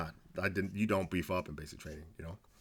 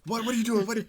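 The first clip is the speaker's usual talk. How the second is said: drill sergeant yell; 12 semitones higher, faster than usual. Retold or imitated. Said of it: "knife hand, like".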